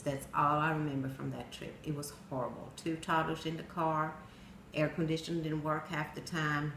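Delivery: usual speaking voice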